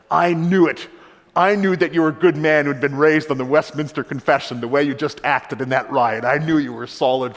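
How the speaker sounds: acted booming excitement at a hunch confirmed; triumphant general. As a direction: burst out, vindicated, as the delighted general.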